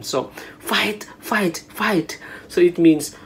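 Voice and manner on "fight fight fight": higher-pitched cheering chant; encouraging cheer. Acted. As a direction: cheer someone on, lightly acted. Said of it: "it means more like encouragement".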